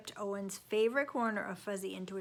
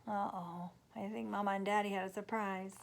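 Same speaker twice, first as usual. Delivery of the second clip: ordinary delivery, nothing marked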